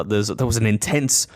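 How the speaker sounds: ordinary talk, nothing marked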